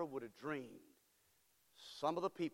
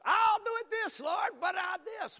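high, loud wheedling shout to the Lord; bargaining believer; 11 dB louder than usual. Retold or imitated. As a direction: plead and haggle with God. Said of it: "Bargaining brothers".

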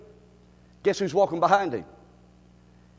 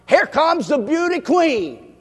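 higher, louder, slow; mock announcement; 6 semitones higher, 6 dB louder, slower than usual. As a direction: present her like a pageant host. Announcing Drusilla's entrance.

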